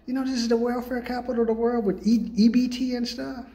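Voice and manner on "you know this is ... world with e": ordinary talk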